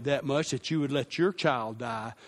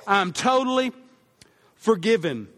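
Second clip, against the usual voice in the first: Southern Baptist preaching; 3 semitones higher than usual. Real, not acted. Pastor rousing the congregation, Southern Baptist fervor. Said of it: "I'm preaching like a Southern Baptist today".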